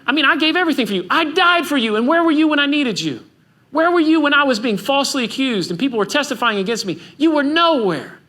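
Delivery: impassioned, high-pitched accusation, acted out; hurt, angry reproach. Imagined Jesus reproaching Peter for denying him, hurt and angry.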